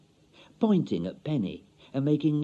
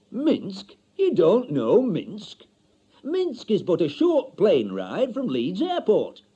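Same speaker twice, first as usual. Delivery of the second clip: higher, slow, loud know-all voice; pompous bureau man; 9 semitones higher, 5 dB louder, slower than usual. Acted. Bureau man scoffing at Mister Stanley, slow and grand. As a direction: talk down to him grandly.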